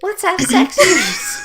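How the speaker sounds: put-on very high, cutesy anime girl; loli voice. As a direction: squeak it like a tiny anime girl. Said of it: "pull out the loli voice".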